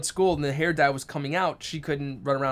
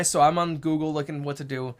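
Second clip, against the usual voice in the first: ordinary talk, nothing marked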